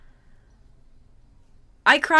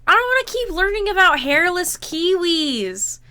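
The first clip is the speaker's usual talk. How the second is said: whiny complaint; 6 semitones higher, 4 dB louder than usual. Real, not acted. Complaining about ad.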